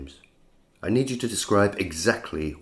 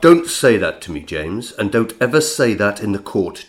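louder, stern rebuke; sharp warning; 7 dB louder than usual. Acted. Lawyer sternly warns his client, louder. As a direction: stop him cold.

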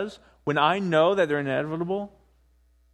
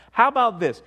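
normal talking voice

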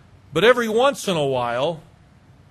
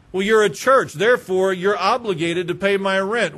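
louder, entitled caller voice; entitled demand; 4 dB louder than usual. Retold or imitated. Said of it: "some of them believe the churches are obligated".

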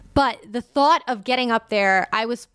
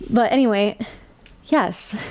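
normal talking voice

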